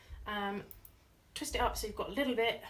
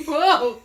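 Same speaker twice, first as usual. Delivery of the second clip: shrieking laugh; 7 semitones higher, 18 dB louder than usual. Real, not acted. Shocked laughing exclamation.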